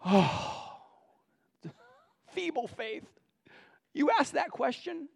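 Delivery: a gentle sigh of mock dismay at weak faith; a fond teacher teasing slow pupils; sighing Jesus. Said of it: "the Lord probably sighs and goes".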